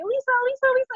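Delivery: high-pitched child calls, imitated; excited little kids. Little kids running up to her, imitated excited calling.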